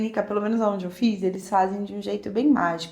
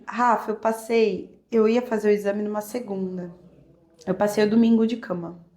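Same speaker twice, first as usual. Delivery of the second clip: ordinary talk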